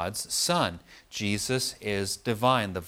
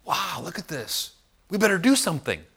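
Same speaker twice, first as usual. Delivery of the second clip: awestruck Peter; 7 semitones higher than usual. Retold or imitated. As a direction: gasp out the wonder, then blurt the plan. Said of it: "this is what's going on with Peter".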